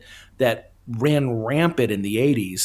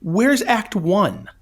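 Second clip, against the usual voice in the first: baffled disbelief; 7 semitones higher than usual. Retold or imitated. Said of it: "I was like".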